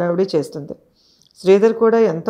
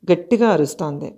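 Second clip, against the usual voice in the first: usual speaking voice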